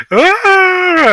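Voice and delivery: sudden high yelp of panic; high panicked yell. Acted. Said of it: "as he's gonna go".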